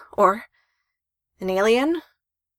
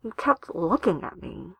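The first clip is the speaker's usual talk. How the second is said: slow, muffled; 5 dB quieter, slower than usual. Acted. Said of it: "Mizuki said slowly, voice muffled".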